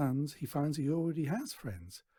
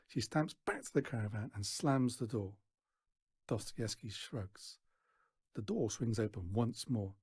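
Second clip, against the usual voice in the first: ordinary delivery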